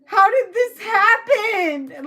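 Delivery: high-pitched hysterical wailing; hammed-up meltdown; freaking out. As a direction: wail it in rising panic, near tears. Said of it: "Like, someone's freaking out".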